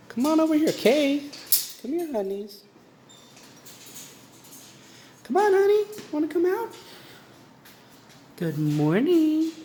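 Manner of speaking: sweet sing-song to lure the bird out; high pet-talk voice. Real, not acted. Coaxing bird out of cage.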